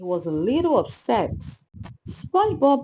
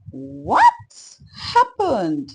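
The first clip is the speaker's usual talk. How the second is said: startled, anxious cry, much higher and louder; 7 semitones higher, 7 dB louder, much slower than usual. A narrator's reading. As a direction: blurt it out in sudden worry. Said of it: "SpongeBob asked anxiously".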